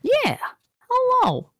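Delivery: bright loud hello, way up high; loud high greeting. Acted. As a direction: call out the hello cheerfully.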